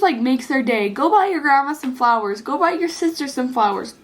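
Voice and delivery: usual speaking voice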